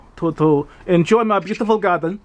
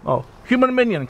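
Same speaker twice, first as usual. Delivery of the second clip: usual speaking voice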